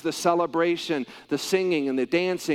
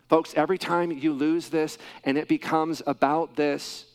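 ordinary talk, nothing marked

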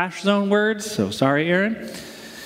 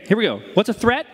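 ordinary talk

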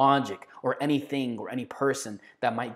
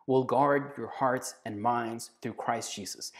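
ordinary talk, nothing marked

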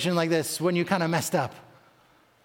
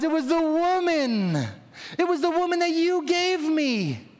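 higher, pleading excuse pushed onto her; defensive blaming Adam; 6 semitones higher than usual. Retold or imitated. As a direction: whine it out like a man dodging blame. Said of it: "the man replies".

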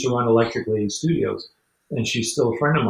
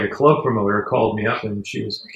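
usual speaking voice

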